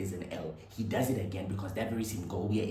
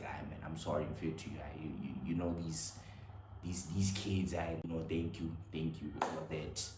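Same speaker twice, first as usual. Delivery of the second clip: soft, hesitant consoling words, much quieter; gentle comfort; 5 semitones lower, 9 dB quieter than usual. Retold or imitated. Dance partner consoling the rejected boy, soft and hesitant. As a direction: ease him down softly like a kind friend.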